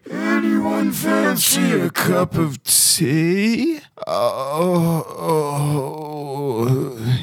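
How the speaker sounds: very high, wavering character voice; feeble, high-pitched old-friend voice; revived Pavilion. Acted. Pavilion comes round after the tree attack, high and feeble.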